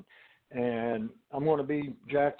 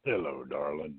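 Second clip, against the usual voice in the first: deep crooning hello, far lower; sugar daddy greeting. Pretend. Guest plays sugar daddy for the host, low croon. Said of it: "I'm gonna start this off with a good".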